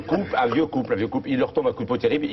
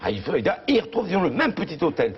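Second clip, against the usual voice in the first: ordinary talk, nothing marked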